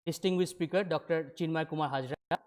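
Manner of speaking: normal talking voice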